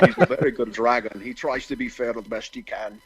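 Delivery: put-on film-star dragon voice; playful celebrity dragon impression; Sean Connery. Shown as a demonstration. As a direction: voice a good dragon as a famous actor. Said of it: "I'd probably do the Sean Connery voice".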